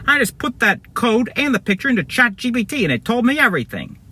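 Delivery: high, put-on villain voice; gloating bad guy. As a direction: explain it smugly in a high character voice.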